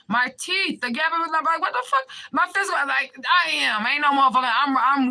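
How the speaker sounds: getting fired up and incredulous; worked-up outburst. Real, not acted. Ranting to viewers about her own teeth, worked up. Agitated self-defence on livestream.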